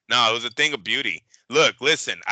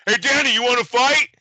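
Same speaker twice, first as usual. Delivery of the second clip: higher, louder urgent question to the fighter; coach asking his fighter; 11 semitones higher, 5 dB louder than usual. Retold or imitated. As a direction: coach pleading with Danny to keep going. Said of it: "And Thomas Taylor goes".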